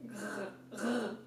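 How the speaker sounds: soft throaty "grr"; little cartoon growl to demonstrate a sound; comic-book growl. Shown as a demonstration. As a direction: growl the French R quietly. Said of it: "you see in comic books, grr".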